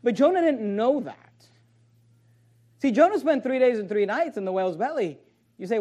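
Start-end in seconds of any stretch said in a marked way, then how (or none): none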